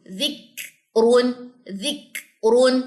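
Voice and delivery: "ذک رون" very slow, k released with a puff, long "رون"; kaf articulation drill. Shown as a demonstration. Teacher drilling kaf with jazm, slow split "ذک رون". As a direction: draw out "ذک رون", pausing on the breathy k. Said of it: "تالو کا جو نرم اور سخت حصہ".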